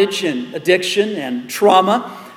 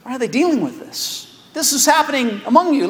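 puzzled Paul; 6 semitones higher than usual. Retold or imitated. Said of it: "He's scratching his head thinking".

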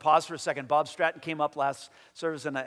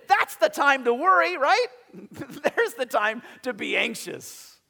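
pitched way up and louder, playful; high excited exclamation; 9 semitones higher, 7 dB louder than usual. Real, not acted. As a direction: sell the punchline with a high, loud burst. Preacher's high excited punchline.

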